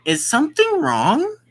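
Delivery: a hint of a worried mom asking; mom's voice. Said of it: "Then our mom speaks".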